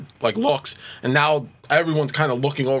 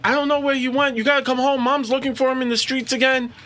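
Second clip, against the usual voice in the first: put-on high, urgent pleading girl's voice; a teen girl, much higher, pressing her father to hurry; upset teenage girl; 11 semitones higher than usual. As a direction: beg her dad to come home. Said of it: "she's like".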